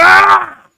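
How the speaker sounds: huge high yell of rage; a real-sounding frustrated shout, very loud and high; retold frustrated scream. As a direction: let out a sudden furious scream. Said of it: "I just started screaming".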